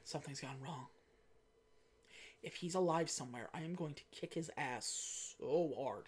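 hushed, brooding M under her breath; a quiet, brooding boss; mock M. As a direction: mutter it like a guilt-ridden boss. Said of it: "she's probably just like".

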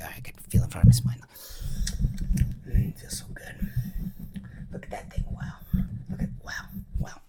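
soft unvoiced whisper, drinking in the feeling; hushed, indulgent murmur; savouring whisper. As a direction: breathe it like you're relishing a treat. Imitating someone savouring sensation.

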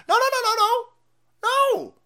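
high, loud no no no; emphatic shout. Real, not acted. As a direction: yell the refusal. Emphatic protest.

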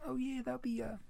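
high, soft put-on cashier voice; lightened voice mimicking the worker; imitating drive-through worker. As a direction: read out the total small and high. Voicing the worker at the window.

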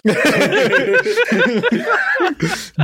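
big high burst of laughter. Real, not acted. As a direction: crack up laughing. Laughs at own joke.

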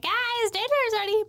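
high bright call to dinner; cheerful kid call. Retold or imitated. Child proudly calls the crew to dinner, high and cheery. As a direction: sing it out like a proud little kid.

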